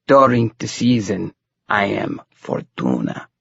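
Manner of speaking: put-on slow, heavy fright-flick Romany accent; Bela Lugosi accent. A narrator's reading. Fortune-teller falls into character, Bela Lugosi accent. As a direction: fall into a Lugosi-style accent, drawing out the name. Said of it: "she fell into character".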